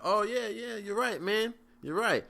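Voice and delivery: mock agreeable, eager to end it; humoring. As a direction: go along nervously to appease him. Humoring the drunk guy.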